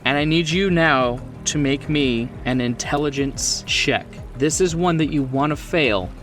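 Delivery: usual speaking voice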